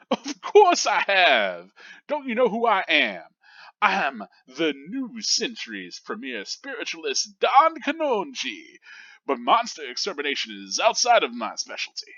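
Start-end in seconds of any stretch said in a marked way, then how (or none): 0.1-8.8 hammed-up TV psychic; boastful showman. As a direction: proclaim your own fame. Boastful spiritualist repeats boast.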